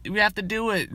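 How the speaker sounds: high eager classmate rallying the team; mock gung-ho cheerleader voice; gung-ho kid. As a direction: chirp it like the overeager kid. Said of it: "That's like the fucking kid in the class".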